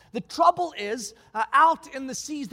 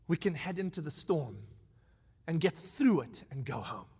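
quiet grave captain; 8 semitones lower, 5 dB quieter than usual. Retold or imitated.

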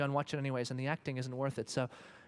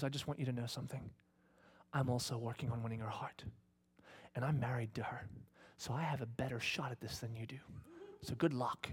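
much softer, sliding into a whisper; calm quiet threat; 3 semitones lower, 9 dB quieter than usual. Retold or imitated. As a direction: speak low and calm, certain of winning. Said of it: "he looks at him and he goes".

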